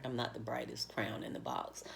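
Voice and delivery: sheepish whisper to herself; a quick whispered joke at her own expense; whispered aside. Real, not acted. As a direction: drop to a breathy self-mocking whisper. Self-deprecating aside.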